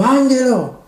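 llamada alegre desde el coche, aguda; gritó. Retold or imitated. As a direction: gritar el nombre desde lejos, animado. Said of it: "Y me gritó". (cliente grita desde su coche: "Ángelo", agudo)

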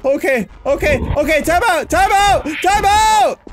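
high, loud panicked shrieks; hammed-up terror, very high; panicked screaming. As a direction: scream for a time out as you get caught. Caught by the chaser in game.

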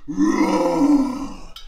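loud, drawn-out giant roar; giant's roar. Acted. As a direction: roar like Goliath facing an army. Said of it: "he roared again like this".

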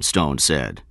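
usual speaking voice

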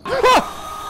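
high startled shriek; startled scream. Real, not acted. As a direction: jump and scream in fright. Startled by mob at night.

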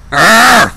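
loud playful zombie growl; zombie roar. Pretend. Zombie jump scare.

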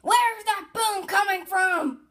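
loud, high, drawn-out pretend scream; big play-acted yell. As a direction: scream it slowly, playing the character. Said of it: "screamed Gold".

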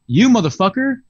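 high, loud retort; angry comeback. Retold or imitated. Carl hurling an insult at the big customer, loud and high. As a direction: fire it back without missing a beat.

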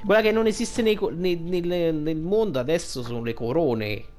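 ordinary talk, nothing marked

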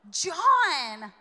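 excited shout. Real, not acted. Preacher exclaiming to the congregation, excited shout. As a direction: shout the name out loud and high.

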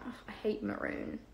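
whispered, half-hearted complaint; half-hearted moan. A narrator's reading. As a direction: grumble it without conviction, under his breath. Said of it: "Ron moaned half-heartedly".

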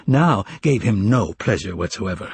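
plain reading voice

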